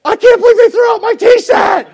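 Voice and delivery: high, loud squeal of mock indignation; mock outraged shout. Preacher mocking a parent upset over her tea set, loud and high. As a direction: play the offended collector, over the top.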